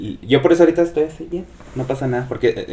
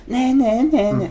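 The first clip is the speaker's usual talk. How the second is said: imitación de gritos; 10 semitones higher, much slower than usual. Retold or imitated. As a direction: mimic someone yelling in nonsense syllables. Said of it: "Así grita ella". (remedo agudo y burlón de gritos)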